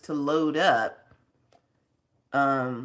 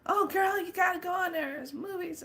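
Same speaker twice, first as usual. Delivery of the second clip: much higher, breathy-light mock friend voice; imitating Deb; 14 semitones higher, 4 dB quieter than usual. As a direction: gush like an excited friend, up high. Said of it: "and I was like".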